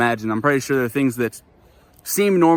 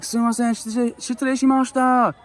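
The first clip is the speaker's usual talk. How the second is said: flustered apology; 11 semitones higher than usual. Retold or imitated. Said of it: "And I was like".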